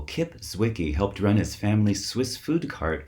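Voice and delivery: ordinary delivery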